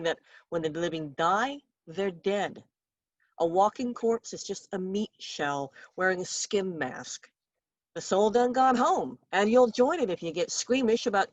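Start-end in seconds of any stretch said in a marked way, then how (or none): none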